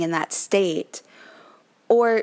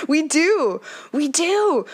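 high, laughing "We do"; 9 semitones higher, slower than usual. Real, not acted. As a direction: laugh through the words. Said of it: "I know you're laughing with me".

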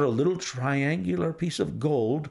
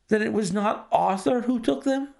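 high, incredulous question; banker's voice rising in disbelief; astonished banker; 10 semitones higher, faster than usual. A narrator's reading. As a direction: struck by sudden hope.

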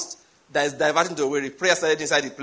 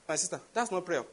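quiet correction; 8 dB quieter than usual. Retold or imitated. Mild correction to praying women.

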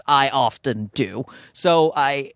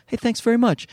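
bright polite mock thanks, pitched up; fake-polite thanks; 9 semitones higher, faster than usual. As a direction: play it sweet and gracious on the surface. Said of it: "you go".

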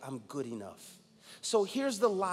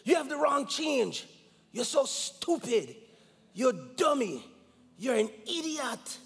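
imitating his father's furious shout, high and loud; father berating his young son over the wrong change, shouting; father yelling; 8 semitones higher, 5 dB louder than usual. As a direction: yell the insults down at your little boy, slowly. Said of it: "And he said".

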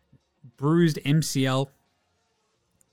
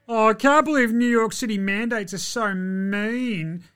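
higher whiny mock voice; mock whiny quote; 6 semitones higher than usual. Mocking Kyrie about city mandates, whiny. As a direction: whine it like a sulking child.

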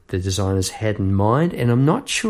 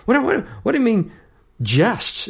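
higher, fast incredulous what-what; indignant inner voice, higher and quick; 11 semitones higher than usual. Retold or imitated. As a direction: splutter in disbelief. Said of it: "I'm thinking to myself".

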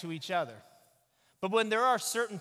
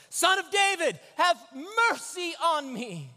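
loud, high, slow pleading yell; desperate shout; 12 semitones higher, 9 dB louder than usual. Acted. Blind man screaming to passing Jesus, desperate loud shout. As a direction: cry out with everything you have. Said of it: "I'm gonna, I'm gonna shout here".

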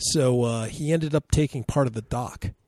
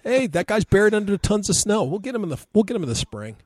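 mock casual; 7 semitones higher, 4 dB louder than usual. Mock locals shrugging off death.

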